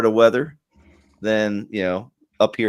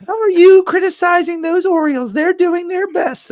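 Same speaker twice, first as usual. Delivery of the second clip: much higher put-on mom voice, scolding; imitating his mom; 3 dB louder than usual. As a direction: squeak it out like a fussing mother. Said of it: "She was like".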